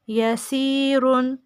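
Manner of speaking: ordinary delivery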